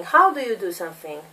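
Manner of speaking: ordinary delivery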